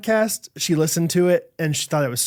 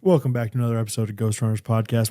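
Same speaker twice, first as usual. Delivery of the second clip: smooth, lower host voice; suddenly calm; 6 semitones lower, faster than usual. Acted. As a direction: switch to calm host mode. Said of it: "Host, now calm".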